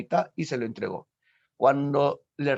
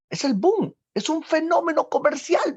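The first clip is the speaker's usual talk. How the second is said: much higher and louder, thrilled; excited exclamation; 5 dB louder than usual. Real, not acted. Teacher telling students about the boom, excited exclamation. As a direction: sell the wonder of the boom.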